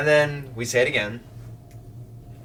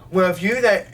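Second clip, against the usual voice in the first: raised, snippy comeback; retorting; 7 semitones higher, 6 dB louder than usual. Retold or imitated. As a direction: snap back at them. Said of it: "they're like".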